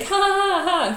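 high, loud forced ha-ha; fake laugh. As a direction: imitate laughing along without getting the joke. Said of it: "I faked my way through that".

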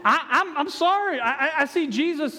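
normal talking voice

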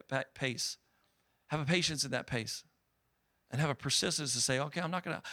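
low, hushed closing exhortation; soft altar-call preaching. Real, not acted. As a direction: gently encourage the congregation, voice dropped. Softens as keyboard player comes up.

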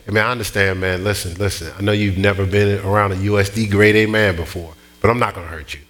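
low, unruffled de-escalation; composed, matter-of-fact firmness; calm reassurance. Retold or imitated. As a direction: talk a threatening man down, low and even. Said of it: "I said it exactly like that".

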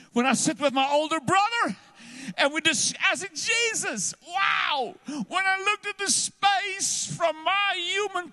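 soar into an ecstatic cry; preaching at full force. Acted. Preacher climax, high and slow.

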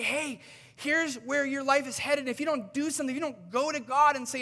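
high, loud, fervent preaching; preaching at full force. Acted. Preacher pleading with listeners to go to God, loud and high. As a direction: urge the congregation with rising fervor.

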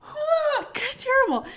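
usual speaking voice

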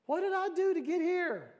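high, puzzled protest; mock bewilderment. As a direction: ask it high, as if baffled. Acting the shocked man in den.

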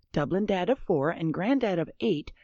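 normal talking voice

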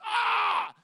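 sudden high, very loud "ah" yell; mock enraged yell. Angry friend at rival fans through the fence, enraged yell. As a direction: let out a big high-pitched roar of fury. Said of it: "shouts through the fence".

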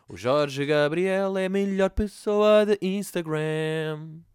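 slow, loud, drawn-out announcement; grand proclamation. Acted. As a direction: declare it like a grand award.

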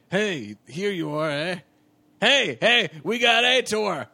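loud, high, slow bandit yell; hammed-up bandit calling out triumphantly; bandit rider. Bandit gloating loudly to the captives he found. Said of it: "and he goes".

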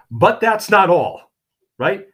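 high, loud TV pitchman; infomercial. Pretend. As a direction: sell it like a late-night ad. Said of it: "I'm gonna sound like a freaking infomercial here".

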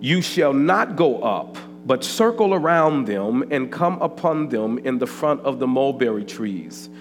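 loud, slow sermon reading over music; full-force preaching. Acted. Pastor preaching scripture loudly over music. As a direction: proclaim the scripture to the church.

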